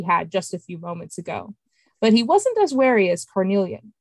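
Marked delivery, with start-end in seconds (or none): none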